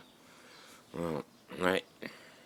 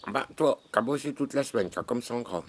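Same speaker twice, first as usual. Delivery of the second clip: excited high voice; 8 semitones higher, 5 dB louder than usual. Acted. Teasing friend, high and loud.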